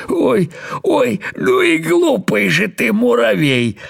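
high, mocking through laughter; a beetle rolling on its back with laughter; laughing beetle. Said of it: "начал хохотать". (высоко, сквозь хохот)

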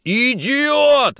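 high, loud furious outburst; explosive anger. Acted. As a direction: blow up at him. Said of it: "взорвался Никитин". (взрыв ярости)

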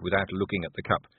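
ordinary delivery

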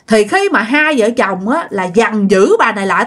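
plain reading voice

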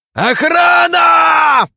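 high, drawn-out roar; roaring for the guards. Acted. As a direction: bellow for the guards. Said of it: "проревел он".

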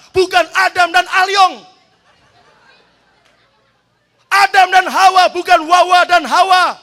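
loud, high shouting sermon; fiery preaching. Real, not acted. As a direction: thunder it at the congregation. Preaching at full force. (khotbah berapi-api dengan teriakan keras)

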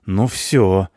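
short menacing warning; angry threat. A narrator's reading. Cleaner about to attack the punk, angry threat. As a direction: snap a final warning. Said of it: "зашипел на него Швабра".

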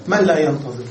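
ordinary talk, nothing marked

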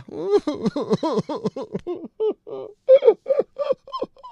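a long, high, rising fit of laughter. Real, not acted. Host cracks up at the story. (高声长笑)

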